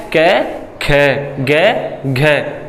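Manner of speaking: कै and खे said slowly, the second with a puff of breath; contrasting aspiration. Shown as a demonstration. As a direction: drag out कै, खे, गै, घे to show the puff of air. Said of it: "मुख के हाथ रख के देखोगे".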